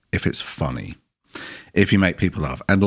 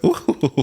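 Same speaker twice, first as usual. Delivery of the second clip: higher-pitched mock "ooh"; playful crowd "ooh"; shocked crowd reaction; 6 semitones higher than usual. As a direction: imitate an audience's scandalised "ooh". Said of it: "so people go".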